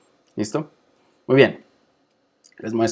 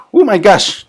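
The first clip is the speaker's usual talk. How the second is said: surprised exclamation; 9 semitones higher, 7 dB louder, slower than usual. Real, not acted. Teacher realizes she talked too long.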